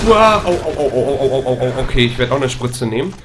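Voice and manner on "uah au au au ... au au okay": ouch ouch, genuinely hurt; pained cry. Real, not acted. Player hit in the game, loud pained yelps.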